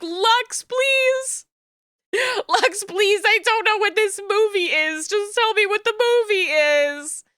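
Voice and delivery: high, whiny pleading to a friend; hammed-up begging to Lux; mock begging. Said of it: "begging and pleading".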